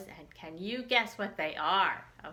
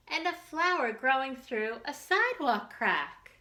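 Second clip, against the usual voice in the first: put-on reading to children; 3 semitones higher than usual. Teacher reads picture book to children.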